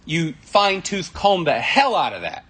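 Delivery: usual speaking voice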